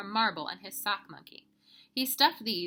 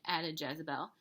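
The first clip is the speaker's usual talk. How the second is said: ordinary delivery, nothing marked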